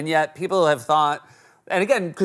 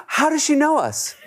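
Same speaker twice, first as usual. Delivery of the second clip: higher-pitched mimicked kid asking in amazement; kids' puzzled question; 11 semitones higher than usual. Father imitates his kids in the park, puzzled and high. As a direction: voice a baffled little kid, pitched up.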